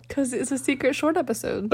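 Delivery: soft secretive hush. Pretend. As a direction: keep it hushed and secret. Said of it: "Why are you talking like that?".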